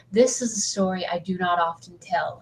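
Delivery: spooky storyteller opening; dramatic reading. Shown as a demonstration. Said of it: "I'll read this dramatically".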